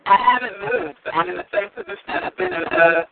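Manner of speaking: bad Bluetooth line, drowned sound; underwater phone voice. Real, not acted. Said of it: "like you're under a hundred feet of water".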